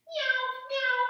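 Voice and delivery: high, loud kitty meow; teacher mewing for the kids in cat pose; playful meow. Pretend. Pretending to be a kitty.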